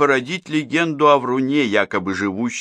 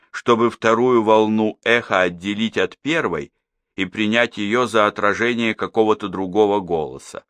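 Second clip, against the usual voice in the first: plain reading voice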